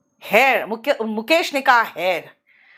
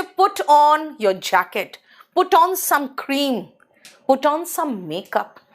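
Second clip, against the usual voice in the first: slow demo; 3 semitones higher, much slower than usual. Shown as a demonstration. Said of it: "lesson examples".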